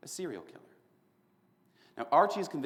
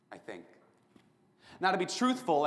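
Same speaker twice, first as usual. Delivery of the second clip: ordinary talk, nothing marked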